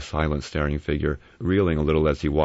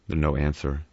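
ordinary delivery

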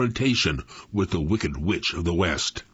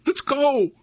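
urgent. A narrator's reading. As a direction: push to bolt now. Scared boys bolting.

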